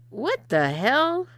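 shocked sputter. A narrator's reading. Man handed razors and underwear, sputtering in shock. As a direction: gasp it out slowly, stunned and outraged. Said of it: "he sputtered".

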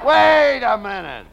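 incredulous halt. Acted. Comic partner halts dodgy money story.

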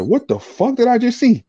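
high, stunned outburst; shocked disbelief. Retold or imitated. Seeing his father at a Denny's, high shocked outcry. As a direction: squeal it like you can't believe your eyes. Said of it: "I said".

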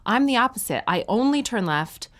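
ordinary talk

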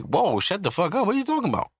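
mock-incredulous, voice shooting up; retold indignant disbelief. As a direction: pitch leaping high on the question. Retold indignant reply to father.

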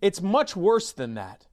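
loud, high-pitched mock politician; Justin Trudeau. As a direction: protest in a high, loud put-on voice. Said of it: "my Justin Trudeau voice".

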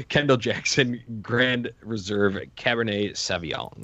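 put-on fancy voice, drawn out. Shown as a demonstration. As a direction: savor the wine's full name, slowly.

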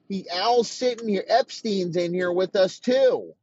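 mock furious coach tearing into him; furious rant. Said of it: "McDaniel's like".

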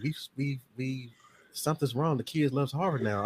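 hushed, hesitant concern; imitated worried parents. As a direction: confide it softly, like uneasy parents. Parents voiced, worried about child.

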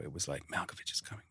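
hushed, breathy crew whisper of anticipation; hushed buildup. Retold or imitated. Crew whispering to the actor that Malkovich is coming. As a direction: mutter it like a nervous crew member.

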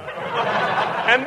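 slow, stretched "And then you eat" with a laugh; drawn-out repeat. Acted. Professor repeating his question to Costello, slow and stretched. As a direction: drag the question out in disbelief.

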